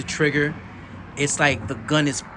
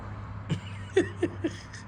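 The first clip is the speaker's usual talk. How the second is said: giggle; 9 dB quieter than usual. Real, not acted. Delighted by the crisp break.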